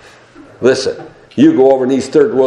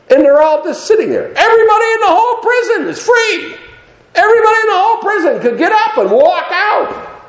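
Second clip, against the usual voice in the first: very high, full-force preacher's cry; exultant preaching. Acted. Preacher announcing the whole prison is free, exultant cry. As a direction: proclaim freedom in a soaring cry.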